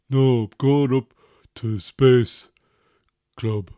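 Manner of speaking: put-on grumbling teen brushing off his father; sulky teenage son. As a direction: play the moody teen who's fed up with his dad. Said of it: "Why are you talking like that?".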